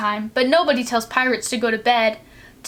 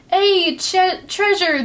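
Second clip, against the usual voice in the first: excited crew shout; 7 semitones higher, slower than usual. Acted. Crew cheering at the chest of treasure, excited shout. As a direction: cry out treasure gleefully. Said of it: "the others shouted".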